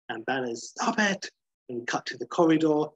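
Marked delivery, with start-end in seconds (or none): 0.7-1.3 high, agitated protest. Acted. Banner cutting off McGee's accusations, agitated. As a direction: cut him off, voice jumping up.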